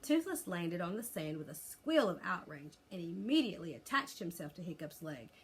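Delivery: ordinary delivery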